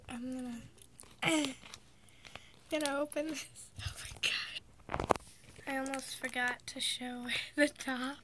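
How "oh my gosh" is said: shocked whisper. Real, not acted. Reacting to hideous toys.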